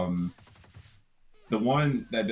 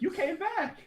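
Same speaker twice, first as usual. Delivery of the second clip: put-on high girlish teasing; girl's voice; 4 dB quieter than usual. Friend voicing Jasmine teasing Huey, high and girlish. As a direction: tease sweetly like a little girl. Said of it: "he's like".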